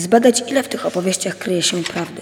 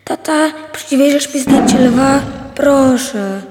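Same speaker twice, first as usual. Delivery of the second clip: pleading little son; 7 semitones higher, 6 dB louder, slower than usual. A narrator's reading. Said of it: "synek szarpał mnie za nogawkę".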